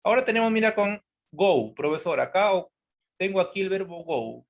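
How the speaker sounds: usual speaking voice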